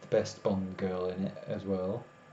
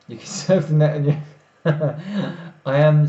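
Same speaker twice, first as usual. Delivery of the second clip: laughing; 5 semitones higher, 11 dB louder than usual. Real, not acted. Laughing while reading chat.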